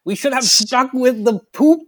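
high, slow, outraged prissy voice; scandalized committee member. Retold or imitated. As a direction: clutch your pearls in a shocked high voice. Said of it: "It's just like".

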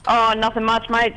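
ordinary talk

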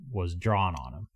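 usual speaking voice

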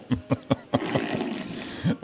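silent breathy laugh; wheezing laugh. Real, not acted. Laughing at slurping.

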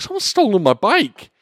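high, loud outraged cry; acted alarm. Younger self telling brother of stolen bike, acted alarm. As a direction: cry out the theft in mock dismay.

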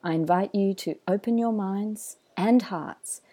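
polished announcer read over music; smooth, measured podcast host intro. Acted. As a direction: draw the listener in like a show opener.

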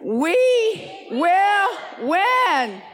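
high, loud, slow cry of "We will win"; stretched battle cry leading a crowd; group declaration shout. Real, not acted. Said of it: "Let's do it again".

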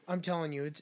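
ordinary talk, nothing marked

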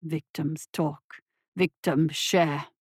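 deep, slow, scornful monster; low slow dismissive creature. A narrator's reading. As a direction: brush it off like a contemptuous beast. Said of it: "said Zhenbu dismissively".

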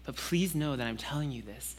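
God softly pleading with a doubter; soft fatherly plea. Pretend. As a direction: speak gently, letting them choose. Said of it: "God saying".

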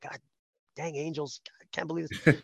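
soft grumbling aside; inner grumble. Retold or imitated. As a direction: grumble it quietly to yourself. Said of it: "thinking in the back of my head".

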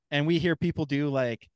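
usual speaking voice

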